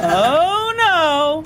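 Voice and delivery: exasperated oh no; father's dismay. Acted.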